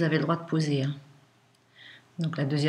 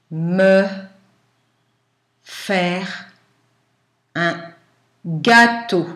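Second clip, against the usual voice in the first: dictating; 3 dB louder, slower than usual. Shown as a demonstration.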